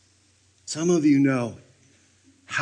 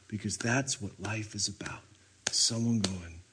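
softer, near-whispered; hushed aside; 4 semitones lower, 8 dB quieter than usual. Acted. Preacher imagining Jesus reacting to Martha's house, hushed. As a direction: murmur it under the breath.

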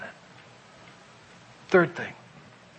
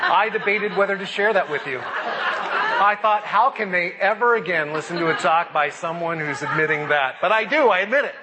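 sheepish, laughing and louder; laughing through it; 4 semitones higher, 5 dB louder than usual. Real, not acted. Pastor confessing a guilty pleasure, laughing. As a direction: talk through embarrassed laughter. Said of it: "I've been watching The Bachelor".

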